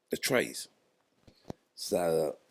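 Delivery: usual speaking voice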